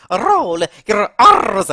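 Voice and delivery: loud, slow trill on the R of "Roll" and "R's". Shown as a demonstration. Mario showing Luigi he does it every time, rolled R's. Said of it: "I keep doing it every time I say".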